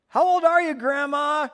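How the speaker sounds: put-on high, loud kid voice; hammed-up little kid asking loudly; blurting child. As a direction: call it out like a tactless child.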